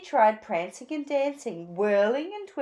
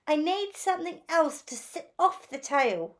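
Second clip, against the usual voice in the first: higher, longing little bird; lightly raised yearning voice; lightly voiced Phoebe; 7 semitones higher than usual. A narrator's reading. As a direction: wish for more, a touch wistful.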